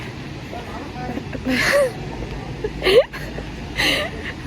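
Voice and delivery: high breathless wheeze of laughter; cracking up, nearly silent; wheezing laugh. Real, not acted. Tipsy laughing fit in vlog.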